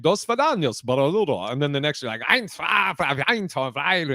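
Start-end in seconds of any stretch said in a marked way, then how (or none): 0.0-1.5 made-up Russian gibberish; mock Russian. Man imitating his date's phone call, mock Russian. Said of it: "sound like ... Russian". 2.2-4.1 counting in an imitation German accent; mock German. Man imitating his date's phone call, mock German. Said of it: "and German".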